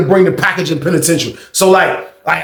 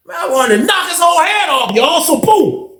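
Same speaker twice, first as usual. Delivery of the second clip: higher, raised voice, fed up; exasperated; 9 semitones higher than usual. Real, not acted. Comedian telling audience he wanted to hit guard, raised and fed up.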